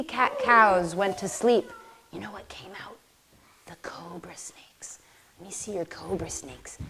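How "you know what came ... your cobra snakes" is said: whisper. Pretend. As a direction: whisper it like a sneaky snake creeping out. Sneaky snakes come out.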